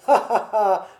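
grand rire sonore, articulé; rire joué. A narrator's reading. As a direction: rire fort comme un bon vivant. Fritz rit joyeusement en parlant. (ha ha ha jovial)

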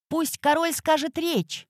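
high, ringing shout from the crowd. A narrator's reading. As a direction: call out to the king. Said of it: "прокричал кто-то из вельмож".

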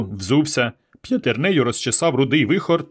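usual speaking voice

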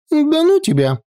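high, drawn-out pout; sulky girl's whine; offended drawl. A narrator's reading. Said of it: "обиженно протянула она".

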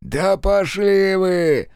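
slow, high, loud snarl of a wounded thug; angry. Acted. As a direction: spit it out with hatred. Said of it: "зло произнёс мужик". (злобный медленный выкрик)

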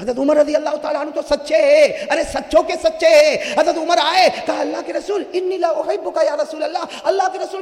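impassioned preacher's climb; fiery sermon. Acted. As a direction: drive it home to the crowd. Story told at full force.

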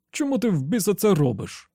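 whiny and offended. A narrator's reading. Slightman protesting to Andy, whiny and hurt. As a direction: plead like a wronged man. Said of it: "плаксиво, ображено спитав Слайтман".

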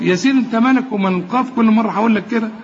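ordinary delivery

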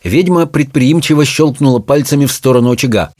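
usual speaking voice

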